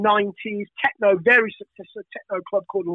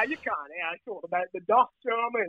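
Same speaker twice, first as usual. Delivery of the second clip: ordinary delivery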